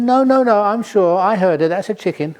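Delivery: the wife won't give in, pitch up and push; insistent wife. Acted. Wife argues chicken.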